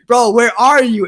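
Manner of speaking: loud, high retold demand; exasperated. Storyteller phoning his late friend, loud exasperation. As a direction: call out for your missing friend, exasperated.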